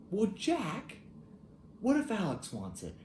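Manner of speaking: a wife asking her husband, mimicked high; imitating Charlotte. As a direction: mimic her eager suggestion. Voices Charlotte's suggestion.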